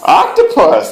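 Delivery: high delighted exclamation; excited praise. Real, not acted. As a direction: cheer the right answer. Parent praising right answer.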